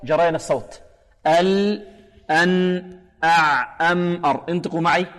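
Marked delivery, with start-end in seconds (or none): 1.3-4.4 ال، أن، أع، أم، أر each with the consonant half-held; intermediate letters. Shown as a demonstration. Teacher drilling students, ال، أن، أع، أم، أر with medium-held endings. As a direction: let the last sound of each of ال، أن، أع، أم، أر flow briefly. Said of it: "خروجه كان متوسطا".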